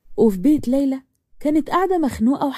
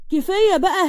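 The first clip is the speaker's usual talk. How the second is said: كفاية بقى higher with a rising end; pleading yell; 7 semitones higher than usual. A narrator's reading. Amal scolds Layla again, higher and rising. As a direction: push the repeat up into a rising cry. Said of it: "فضلت تزعق فيها".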